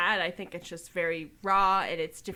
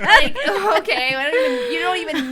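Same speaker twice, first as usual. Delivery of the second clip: laughing; 11 semitones higher, 12 dB louder than usual. Real, not acted. Laughing at teen angst.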